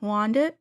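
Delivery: WANDERED said as "wandit"; imitated pronunciation. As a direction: drop the R in WANDERED and end it on "-it". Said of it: "she's saying, like".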